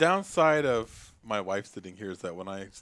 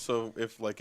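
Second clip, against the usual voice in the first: ordinary talk, nothing marked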